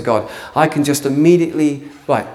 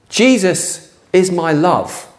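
slow tender declaration; 3 semitones higher, much slower than usual. Shown as a demonstration. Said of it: "talking like a guy like that".

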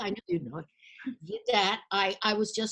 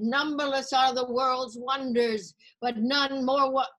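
slow, raised declaiming, cut off; tragic recital; 5 semitones higher, 5 dB louder, slower than usual. Retold or imitated. Student starting her final speech again, slow declaiming. Same speech retried in class.

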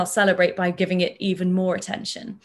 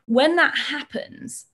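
usual speaking voice

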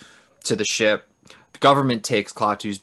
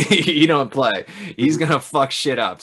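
laughing warning; 4 semitones higher, 5 dB louder than usual. Retold or imitated. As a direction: hype up the killer robot. Warning about Gort.

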